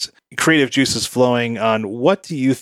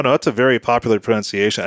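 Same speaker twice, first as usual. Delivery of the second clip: normal talking voice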